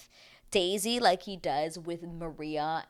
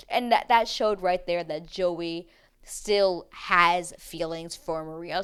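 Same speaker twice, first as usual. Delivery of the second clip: ordinary delivery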